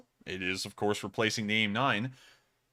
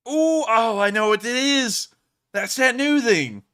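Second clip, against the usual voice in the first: excited recognition; 10 semitones higher, 8 dB louder, slower than usual. Real, not acted. Recognizes the weapon on screen.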